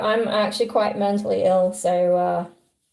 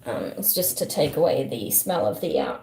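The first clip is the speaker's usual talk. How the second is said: usual speaking voice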